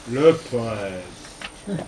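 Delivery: grand poète déclamé; le poète, dit avec emphase et une montée; poète emphatique. Acted. (le poète, dit avec emphase)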